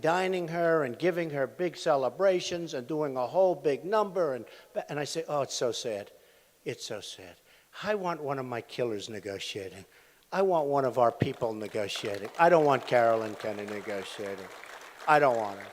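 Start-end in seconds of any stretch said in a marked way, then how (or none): 5.3-15.7 much lower and softer, a mock-mournful sigh; mock lament. Quoting himself lamenting the ambassador negotiating, low and soft. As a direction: sag down low, hamming up the sadness.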